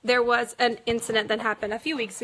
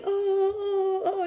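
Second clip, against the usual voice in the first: mock pained oh-oh-oh, pitched high; hammed-up hurt moans; imitated crying moans; 8 semitones higher, slower than usual. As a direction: act out groaning in pain in bed. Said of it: "you know, crying".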